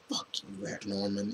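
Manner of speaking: hissed under the breath; whispered curse. Retold or imitated. Viewer cursing Norman Osborn on screen, whispered. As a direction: whisper it like a grudge in the dark. Cursing the villain while watching.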